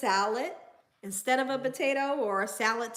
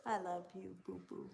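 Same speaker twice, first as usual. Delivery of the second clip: much softer, ending in a whisper; 14 dB quieter than usual. Real, not acted. Owner cooing to her dog, soft whisper. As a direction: coo softly to the pup, dropping to a whisper.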